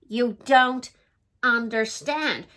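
ordinary delivery

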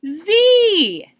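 high, loud, drawn-out "Z"; a long triumphant exclamation; excited exclamation. Shown as a demonstration. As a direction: finish with a big excited shout. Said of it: "more exclamation marks".

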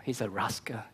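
breathy whispered verdict. Retold or imitated. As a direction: lean in and whisper it. Pastor condemning false preacher.

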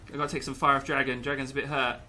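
normal talking voice

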